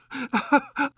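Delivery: high squealing laugh; little scream of laughter. Acted. Alice laughing at the Queen's boxed ears, high squeal of laughter. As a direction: burst into a shrill giggle. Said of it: "a little scream of laughter".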